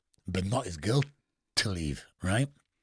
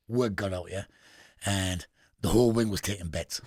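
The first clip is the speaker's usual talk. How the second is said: ordinary delivery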